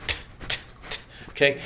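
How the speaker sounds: crisp unvoiced 'T' burst; explosive t. Shown as a demonstration. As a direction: spit out 'T, t' sharply. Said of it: "an explosive consonant".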